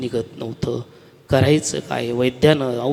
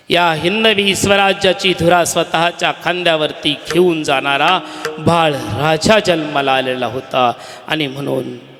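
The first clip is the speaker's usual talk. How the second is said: ordinary talk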